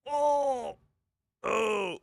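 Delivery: high, guttural announcer "oh"; high demo of a stuffed-up call; congested chesty exclamation. Shown as a demonstration. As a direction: congest yourself and push it from the chest. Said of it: "you have to kinda congest yourself up here".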